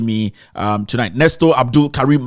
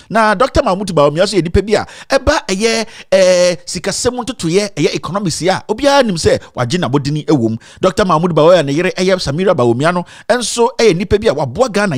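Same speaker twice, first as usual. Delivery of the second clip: lively Twi newscaster delivery, a bit higher; mock news read; 6 semitones higher than usual. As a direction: read it like a Kumasi radio newscaster. Reads Twi news after "news.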